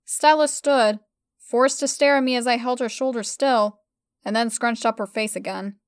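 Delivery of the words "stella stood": plain reading voice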